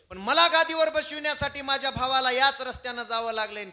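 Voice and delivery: high, loud lament; anguished outburst. Acted. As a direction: cry it out in grief, high and loud. Bharat's imagined lament, acted.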